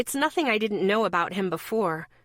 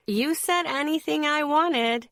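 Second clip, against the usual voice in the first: high, lilting teasing tune; sing-song voice; 7 semitones higher than usual. Acted. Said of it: "in my sing-song voice".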